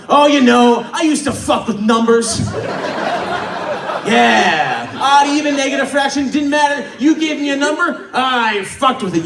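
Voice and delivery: hammed-up, aggressive bragging; psychopathic boasting. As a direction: build into a manic boast about your job. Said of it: "All right, psychopath, calm down".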